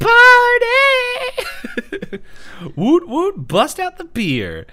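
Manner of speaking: high, loud mock drunken whoop; hammed-up drunk cheer, drawn out at the end; drunk party girl. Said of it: "a little bit too drunk".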